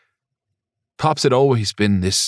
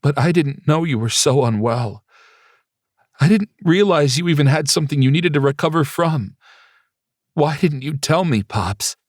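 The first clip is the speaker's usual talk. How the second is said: higher, pleading and distressed; upset son; 6 semitones higher than usual. Acted. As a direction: plead with him through rising tears. Son upset, learns father ill.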